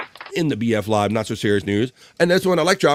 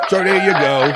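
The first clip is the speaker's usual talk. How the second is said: loud catchphrase; 6 semitones higher, 7 dB louder than usual. Acted.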